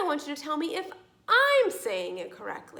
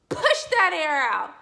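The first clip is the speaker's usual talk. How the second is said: "push that air out" punched louder; emphatic; 2 semitones higher, 7 dB louder than usual. Shown as a demonstration. Teacher showing how the P sound is made, emphatic "push that air out". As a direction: hit "push" hard in "push that air out".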